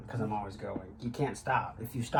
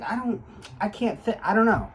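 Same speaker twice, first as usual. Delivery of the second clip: flustered; 9 semitones higher, 5 dB louder than usual. Retold or imitated. Flustered quote of being stuck.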